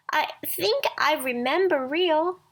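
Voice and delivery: a toy rabbit recalling something dear; rabbit remembering. A narrator's reading. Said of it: "said Velveteen Rabbit".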